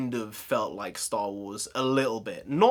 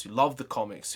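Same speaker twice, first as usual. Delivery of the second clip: normal talking voice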